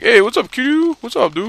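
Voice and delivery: a hint of sudden fake friendliness; mock friendly greeting. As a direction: sell the fake chumminess. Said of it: "all friendly and nice to you".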